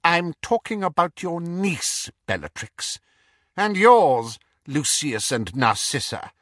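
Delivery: high, cold, mocking villain voice; cold dark lord. Dark lord mocking the Death Eaters, cold and sneering. Voldemort taunting at the table.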